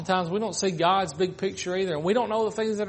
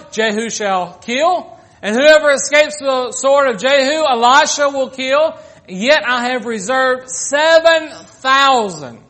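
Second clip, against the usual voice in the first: loud, high proclamation of God's words; preacher voicing God's command; thundering preaching; 6 semitones higher, 9 dB louder than usual. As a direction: boom God's answer from the pulpit. Preaching God's reply at full force.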